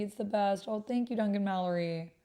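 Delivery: usual speaking voice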